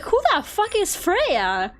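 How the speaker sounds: high, slow, baffled "who is that"; exaggerated confused outburst. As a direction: shoot it out, dumbfounded. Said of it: "And I'm like".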